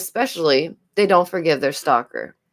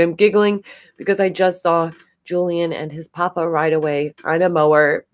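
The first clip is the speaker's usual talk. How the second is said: normal talking voice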